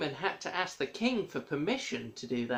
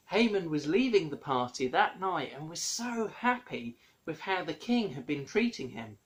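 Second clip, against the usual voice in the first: normal talking voice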